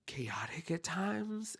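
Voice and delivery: breathy whisper, slowed down. Real, not acted. Host whispering to listeners about the chaotic church, whisper. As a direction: drop to a whisper for the last words.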